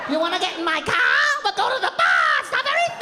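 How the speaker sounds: high Boston squawk rising into seagull cries; Boston accent, angry seagull. Acted. Boston date invites him to the bar, seagull-like accent. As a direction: screech the Boston accent until it turns into a gull. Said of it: "They sound like angry seagulls".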